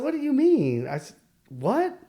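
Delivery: stunned, can't take it in; shocked disbelief. Retold or imitated. Told his son was born with one leg, stunned. Shocked at son's condition news.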